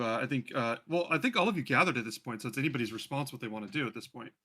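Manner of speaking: ordinary talk, nothing marked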